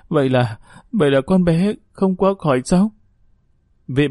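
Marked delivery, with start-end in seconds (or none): 0.1-2.9 stunned, halting question rising high in distress; falter, then voice the dreaded question; dazed disbelief. A narrator's reading. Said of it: "Bà Tuyết thẫn thờ". (thẫn thờ, nghẹn ngào không tin nổi)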